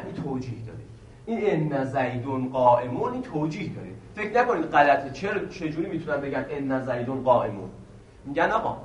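ordinary talk

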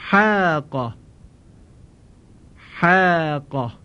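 حاق with a thin, light long آ; recitation demo. Shown as a demonstration. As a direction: model حاق with the vowel kept light. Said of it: "بعدش صدای کشیدهٔ آ".

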